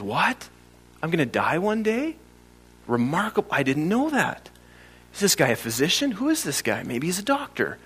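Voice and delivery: hushed, naive mock wonder; mock astonishment. Preacher mimicking crowd puzzled at hearing they'll die, hushed mock surprise. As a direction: softly play someone amazed by the obvious.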